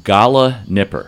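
slow, deliberate pronunciation; savoring each word. Shown as a demonstration. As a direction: say it slowly, syllable by syllable. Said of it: "say it again slowly".

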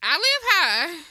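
"I live high" slow, high and loud; emphatic insistence. Retold or imitated. Explaining the balcony to mom, "I live high" drawn out emphatically. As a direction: stretch out "I live high" with a big pitch swell.

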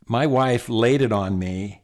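ordinary talk

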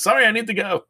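put-on high, hurried girl voice; panicked little exit line; flustered girl.

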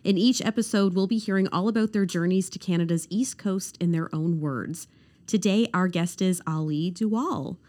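upbeat, announcer-style welcome read; podcast intro read. Acted. Host welcoming listeners to the show in an announcer read.

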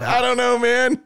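said through real laughter; giggling, embarrassed at himself; laughing. Real, not acted. Host laughs off his own strange recording.

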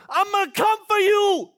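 loud, high, snarling threat; furious threat. Retold or imitated. Angry rival threatening the pastor on court, loud and high. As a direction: hurl the threat at him full force. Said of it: "he was furious".

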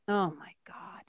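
breathless whispered awe; awed whisper. Real, not acted. Moved by the lesson, whispering in awe. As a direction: whisper it, overwhelmed.

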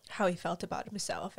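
ordinary talk, nothing marked

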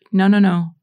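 ordinary talk, nothing marked

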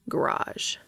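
low, hushed certainty; quiet authority. A narrator's reading. Cass replies to dazed Dean, quietly authoritative. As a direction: answer in one low, sure word. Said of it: "an unquestionable note of authority".

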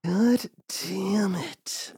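long, whispery stretched words; hushed exclamation. Acted. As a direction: draw it out slowly in a whisper. Hushed, drawn-out mock amazement.

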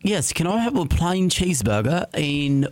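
slow, high British put-on; British accent. Shown as a demonstration. Demonstrating his drive-thru order in a slow British accent. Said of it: "Can you do it for us one time?".

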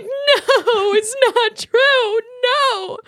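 high, loud mock wail; retold childhood sobbing. Child refusing dad's confession in a dream, mock sobbing. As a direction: imitate a sobbing kid's long, drawn-out protest. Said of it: "I'm just sobbing as a kid".

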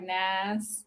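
"Nas" said low and soft with a flat Southern "ah" vowel; Southern "Nas", the vowel opened to "ah"; Southern accent. Shown as a demonstration. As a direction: say "Nas" as a Southern drawled "nice". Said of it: "it's just not just nice".

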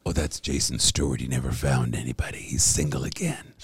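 lightly voiced neighbors clucking over the single guy; gossiping neighbors. Neighbors on the block gossip about him, low and hushed. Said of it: "people live at the end of the block and they go".

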